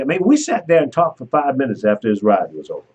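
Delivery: normal talking voice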